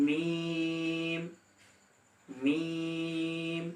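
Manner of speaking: মিম hummed long and soft; Arabic letter meem. Shown as a demonstration. Teacher reciting মিম slowly. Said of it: "এরপর".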